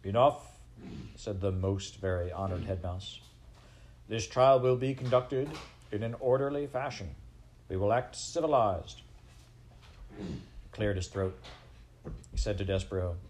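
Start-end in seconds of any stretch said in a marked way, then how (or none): 0.0-0.6 loud, sudden call to order; commanding. Acted. Head mouse silencing the crowd. 4.1-9.0 higher, firm official pronouncement; commanding authority. Acted. Head mouse calling crowd to order.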